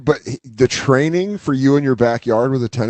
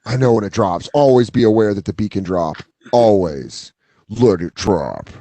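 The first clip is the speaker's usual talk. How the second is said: low, measured sage voice; solemn rave master; 6 semitones lower than usual. Pretend. Acting solemn rave-training master.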